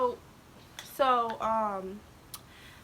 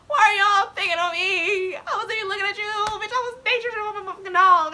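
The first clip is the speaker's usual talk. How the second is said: tearful protest; 8 semitones higher, 6 dB louder, faster than usual. Retold or imitated. Her tearful protest in class.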